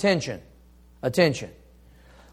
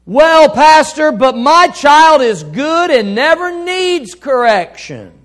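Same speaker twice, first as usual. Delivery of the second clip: high, loud and slow, a put-on protesting dad; defensive father; 7 semitones higher, 8 dB louder, slower than usual. A father objecting to the pastor, loud and slow. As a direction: play the father who insists his child needs no correction. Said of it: "A father may say this".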